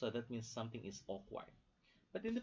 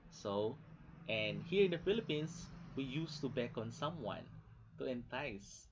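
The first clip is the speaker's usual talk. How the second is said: normal talking voice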